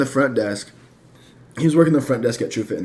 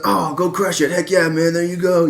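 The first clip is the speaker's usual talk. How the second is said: higher, hyped frat-guy cheer; 6 semitones higher than usual. Retold or imitated. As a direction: cheer him on like a bro. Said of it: "I sound like your typical frat guy".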